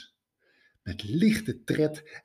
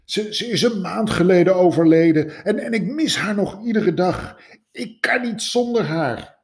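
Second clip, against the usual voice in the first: higher, tearful voice; grieving man telling of his wife, higher and tearful; sobbing widower; 9 semitones higher, 5 dB louder than usual. A narrator's reading. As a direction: speak through tears, pitched up. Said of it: "snikte de man".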